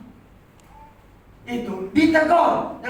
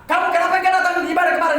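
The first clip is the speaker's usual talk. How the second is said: mock rebuke; 3 semitones higher, much faster than usual. Pastor acting out a rebuke.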